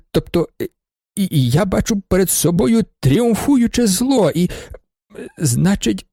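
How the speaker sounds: pompous address breaking into stammers; faltering speech. A narrator's reading. Nervous witness addresses the judge, grandly then faltering. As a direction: start grandly, then stumble between long halts. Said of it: "Раптом у мене так пересохло в горлі".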